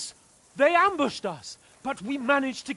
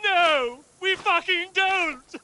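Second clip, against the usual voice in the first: angry shouting; 8 semitones higher than usual. Acted. Arguing with guards at the gate.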